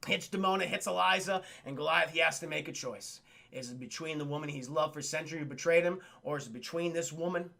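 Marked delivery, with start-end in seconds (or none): none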